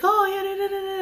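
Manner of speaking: high, singsong mimicry of idle chatter; mocking chatter. Mocking a man going on about a game, mock chatter. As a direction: parrot his rambling in a high pitch. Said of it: "talking about shit that don't matter".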